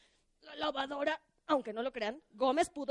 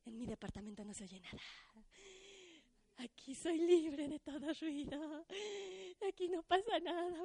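hushed relief; 12 dB quieter than usual. Acted. Liliana relieved in quiet home.

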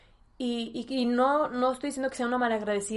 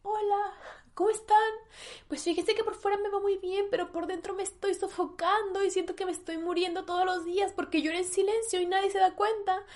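mock confession; 8 semitones higher than usual. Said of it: "aprender a decir".

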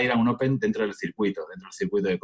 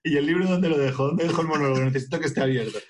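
higher, hurried nervous performer; nervous stage comedian; 7 semitones higher, faster than usual. Acted. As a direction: stall like a nervous beginner on stage. Acts out himself nervous on stage. (cómico nervioso en el escenario)

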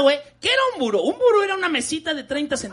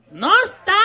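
higher, louder whining shout; whiny kid yell; 6 semitones higher, 6 dB louder than usual. Retold or imitated. Teen yelling to mom that the item is missing, loud whining shout. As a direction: yell it like a dumb teenager.

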